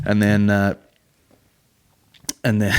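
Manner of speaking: normal talking voice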